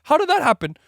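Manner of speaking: high, loud playful surprise; mock surprise. Seller amazed at first sales, playful mock surprise. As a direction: act amazed at your own success. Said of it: "you're like".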